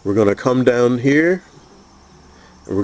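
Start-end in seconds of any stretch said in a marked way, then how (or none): none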